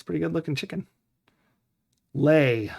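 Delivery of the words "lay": ordinary talk, nothing marked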